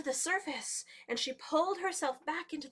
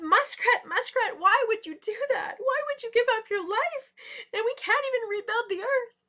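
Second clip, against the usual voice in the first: high, loud anguished pleading; crying out; 10 semitones higher, 9 dB louder than usual. A narrator's reading. The animals cry over muskrat's death, loud pleading. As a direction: wail it as a storyteller's lament. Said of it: "they started to cry".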